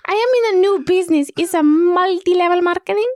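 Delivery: high tiny-creature voice; squeaky little character voice. Pretend. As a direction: play a small eager salesman. Playing a character called Ramplu.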